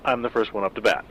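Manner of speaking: ordinary delivery, nothing marked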